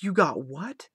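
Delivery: shocked disbelief. Retold or imitated. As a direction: react in alarm at the news. Hearing friend got mugged.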